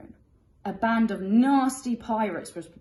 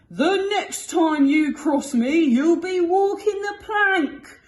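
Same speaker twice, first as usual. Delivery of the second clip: loud, higher, slow furious threat; roaring captain; 11 semitones higher, 11 dB louder, slower than usual. Acted. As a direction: roar the threat, full of rage. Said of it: "roared the captain".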